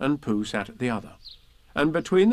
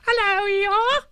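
very high, loud squeak; squeaky Roo; 6 dB louder, slower than usual. Acted. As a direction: squeak it out like an excited little kangaroo.

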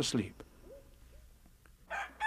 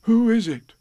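high, loud questioning Pooh; 10 semitones higher, 6 dB louder than usual. A narrator's reading. Pooh asks who is outside, high loud call. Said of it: "So he said".

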